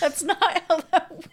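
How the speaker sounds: high, loud laughing protest; laughing while talking. Real, not acted. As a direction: laugh the words out in disbelief. Reacting to an absurd story.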